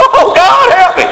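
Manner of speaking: high-pitched mock cry for help; mock scream. Preacher mocking a crying soul in hell, high mock scream. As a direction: shriek it as a soul burning in hell. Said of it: "he's screaming".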